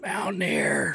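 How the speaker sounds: soft, distant mock holler; far-off voice. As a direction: call it faintly, as if from far down the store. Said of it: "far-off voice going".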